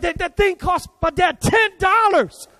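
high, slow, outraged yell; animated shout. Retold or imitated. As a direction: shout it like a kid who can't believe it. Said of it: "Marcus was animated, very animated".

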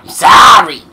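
loud, high yelled sorry; imitated shouted apology. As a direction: holler the apology from afar, in fun. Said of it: "He's like".

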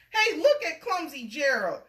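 sneering animals. A narrator's reading. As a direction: jeer at the clumsy giraffe. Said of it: "the animals all sneered".

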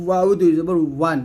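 ওয়াও as w with rounded, open lips; rounded wāw. Shown as a demonstration. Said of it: "ওয়াও দুই ঠোঁট গোল করে". (ওয়াও ঠোঁট গোল করে)

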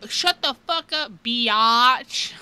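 loud, high mock outburst, drawn out; teacher cracking. Fed-up teacher to rude kids, loud retold outburst. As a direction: imitate a teacher losing it at full volume. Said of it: "one day she cracked and she goes".

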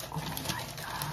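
sharp high gasp, then a breathless whisper; stunned, breath caught; gasp of awe. Real, not acted. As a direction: gasp as you see it. Discovering the collage book.

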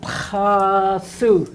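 "Pasu" with a throaty s going back; teacher's "Pasu" demo; guttural pasu. Shown as a demonstration. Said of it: "It's a guttural sound".

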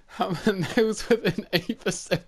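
giggling through the words; can't stop laughing; laughing while talking. Real, not acted. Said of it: "I can't stop laughing".

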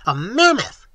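high, loud triumphant cry; triumphant bawl. A narrator's reading. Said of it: "bawled Professor Small triumphantly".

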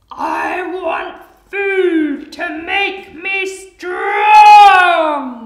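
slow, roaring high and very loud; hungry crocodile, demanding. Acted. Crocodile on the hunt for food, hungry roar. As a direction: bellow it out, stretching every word.

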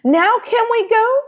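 put-on excited little boy itching to go; impatient boy. Pablo begging Abuela to leave, high and loud.

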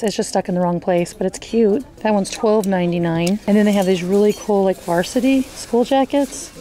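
usual speaking voice

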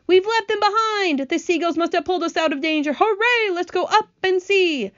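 high, excited cheering; jubilant. A narrator's reading. Characters cheer their escape from danger.

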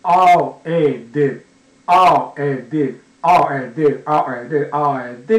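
あお、え、で as R, eh, d, loud and separate, then closer each round; sounds run together. Shown as a demonstration. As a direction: repeat あお、え、で, tightening the gaps each time. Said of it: "短くすると".

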